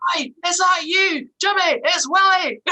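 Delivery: put-on high, loud Fife accent greeting. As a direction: hail an old schoolmate in broad Fife, shouting across the square. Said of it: "got Fife accent on".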